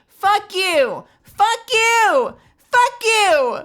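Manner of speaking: high, loud repeated yells; panicked shouting. Real, not acted. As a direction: yell it in a panic.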